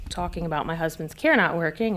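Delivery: usual speaking voice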